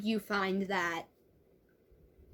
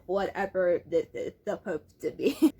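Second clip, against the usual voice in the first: plain reading voice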